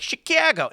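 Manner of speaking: high mock "Chicago" with a flattened "cat" vowel; fake Chicago vowel. Outsiders doing the bit, "Chicago" with an exaggerated cat vowel. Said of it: "they do the bit where".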